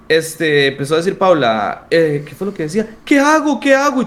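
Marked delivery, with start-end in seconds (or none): 3.0-4.0 panicked friend quoted. Retold or imitated. Paula panicking on the bus.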